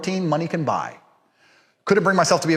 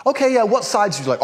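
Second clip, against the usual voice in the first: higher-pitched mock waiter voice; waiter's question; 9 semitones higher, 4 dB louder, faster than usual. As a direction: chirp the question like an imitated server. Waiter asks about side dishes.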